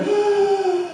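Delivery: a high, hammed-up gasp of wonder; mock awestruck gasp. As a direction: gasp like you just fell in love. Sudden awe at the glass.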